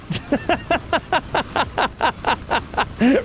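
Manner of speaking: airy high-pitched laugh at the chat. Real, not acted. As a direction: burst out laughing, high and soft.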